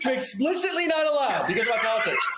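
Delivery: half-laughing at the absurdity. Real, not acted. Telling friend he's banned from bars.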